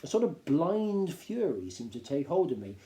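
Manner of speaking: ordinary delivery, nothing marked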